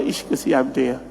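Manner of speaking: low, soft pitying remark; retold sympathy. Pupils pity their sued teacher friend, low soft sympathy. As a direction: drop the voice in mild pity.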